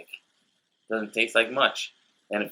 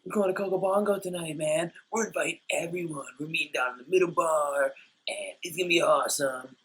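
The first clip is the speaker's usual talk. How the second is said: high, fast, softer party-goer impression; excited vacation couple; 8 semitones higher, 5 dB quieter than usual. Couple inviting everyone to Coco Bongo, high excited mock voice. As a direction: imitate excited tourists in a raised voice. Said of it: "this couple came up and they're like".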